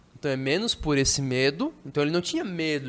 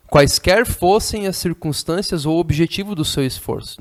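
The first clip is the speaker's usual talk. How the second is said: reading a novel aloud; 2 semitones higher, 8 dB louder than usual. Acted. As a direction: declaim the passage to the class.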